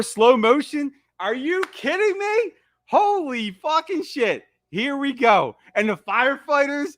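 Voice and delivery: high-pitched, awestruck excitement; real hyped excitement. Real, not acted. Fan watching the greatest Halloween scene, thrilled.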